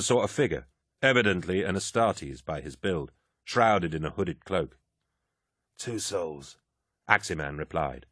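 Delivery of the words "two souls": dark whisper behind you. Acted. Password at the lodge door.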